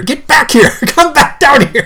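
high, annoyed mock yell with a laugh; retold yelling. Owner calling a cat down from the ceiling, laughing mock yell. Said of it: "I was yelling at her".

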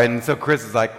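ordinary delivery

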